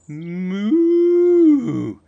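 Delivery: mooing like a cow. Pretend. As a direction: moo like a cow. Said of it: "That's a cow".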